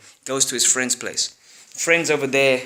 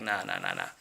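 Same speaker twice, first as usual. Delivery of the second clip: dismissive bros; 10 dB quieter than usual. Acted. Friends dismiss him.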